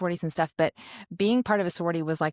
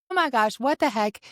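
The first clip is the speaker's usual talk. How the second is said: higher-pitched startled exclamation; retold shock; 6 semitones higher, 4 dB louder than usual. Woman retells hurting her arm at the frat house, shocked. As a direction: replay your alarm, higher and indignant.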